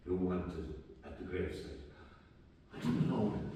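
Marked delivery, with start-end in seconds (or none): 0.1-1.8 low, hushed urgent message; whispered in the ear. Retold or imitated. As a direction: deliver it discreetly, low and soft. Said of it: "and whisper in my ear".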